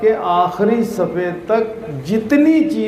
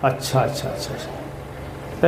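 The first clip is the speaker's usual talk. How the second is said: hushed; 6 semitones lower, 8 dB quieter, slower than usual. Real, not acted. Teacher hushing children.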